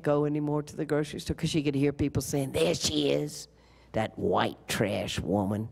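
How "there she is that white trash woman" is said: low, slow, contemptuous mock townsperson; a lightly voiced scornful neighbour; sneering gossip. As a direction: spit the insult out slowly and low. Townspeople sneering at Miss Sonia.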